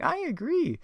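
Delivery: very high cartoon falsetto; Daphne. Daphne agreeing with the gang, high falsetto impression. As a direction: pipe up in a put-on high voice. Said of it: "And then Daffy's like".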